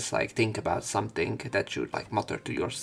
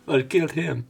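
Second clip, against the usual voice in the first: excited laughing; 5 semitones higher, 5 dB louder than usual. Real, not acted. Still reacting, laughing.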